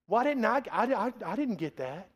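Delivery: mildly whiny, stammering complaint; whiny older brother. Retold or imitated. Preacher imitates the jealous older brother, lightly whiny. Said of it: "he's just whiny".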